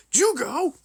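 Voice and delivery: very high, rising call of a name; loud, worried query; concerned question. Shown as a demonstration. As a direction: let the pitch shoot up questioningly. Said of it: "he says this as he's concerned".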